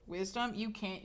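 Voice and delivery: usual speaking voice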